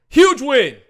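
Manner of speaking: loud, high, excited burst; triumphant shout. Real, not acted. Celebrating the playoff win, triumphant high shout.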